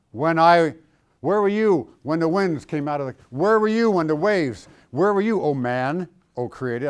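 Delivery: loud, booming divine challenge, building; God thundering. Acted. God confronting Job, loud booming rebuke. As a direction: thunder down at a small man.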